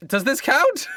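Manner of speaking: high, whimpering scout; anxious scout voice. Acted. Scout asks if the kill counts, high whimpering voice. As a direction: squeak it out high and worried.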